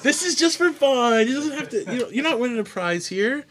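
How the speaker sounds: high, giggly self-defence; laughing protest. Real, not acted. Defends quiz question, laughing.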